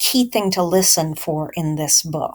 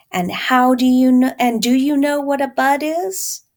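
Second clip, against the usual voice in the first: louder, higher mama asking; questioning mother; 5 semitones higher, 5 dB louder than usual. Acted. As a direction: ask it like a mama who loves the answer. Mother voiced in story.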